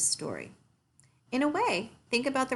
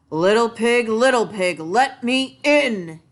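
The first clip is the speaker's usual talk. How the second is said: loud, higher big bad wolf, slow on let me in; growled wolf voice; 4 semitones higher, 8 dB louder than usual. A narrator's reading. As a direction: growl it out like a hungry wolf at the door. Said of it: "he growled".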